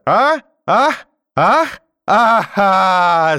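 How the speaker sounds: high, loud cries breaking into a long wail; repeated gasped "ah" bursting into sobs; hysterical sobbing cries. A narrator's reading. Grandmother breaking down mid-tirade, sobbing wildly. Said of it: "зарыдала вдруг бабушка".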